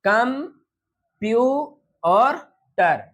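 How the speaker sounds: कम, प्यू, टर with pauses between; syllable by syllable; split into syllables. Shown as a demonstration. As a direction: tap out कंप्यूटर one beat at a time. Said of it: "कंप्यूटर में three syllable".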